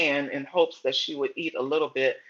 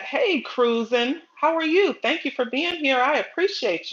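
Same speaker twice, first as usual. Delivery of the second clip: excited greeting; 9 semitones higher than usual. Real, not acted. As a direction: stretch the name out, then welcome them up high. Greeting a viewer on livestream.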